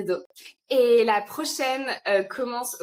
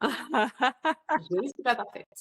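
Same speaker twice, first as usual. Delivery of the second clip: laughing; 3 semitones higher, slower than usual. Real, not acted. Laughing at her messy house.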